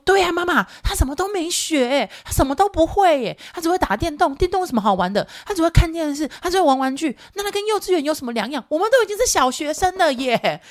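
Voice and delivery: 高声学女儿，拖长调数落人; 学孩子一本正经地嫌弃别人; 小大人的女儿. Retold or imitated. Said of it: "我女儿讲话就是呈现这种状态啊". (妈妈高声模仿女儿数落男同学)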